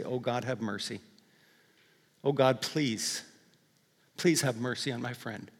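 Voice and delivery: pleading prayer. Acted. Believer praying for a hurting friend, quiet pleading. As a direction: beg God quietly for a friend.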